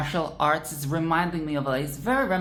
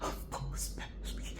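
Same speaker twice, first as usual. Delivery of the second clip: soft ASMR whisper; hushed whisper; 6 semitones lower, 12 dB quieter than usual. Real, not acted. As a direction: breathe it like an ASMR clip. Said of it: "the A S M R".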